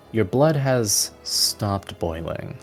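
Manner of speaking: ordinary talk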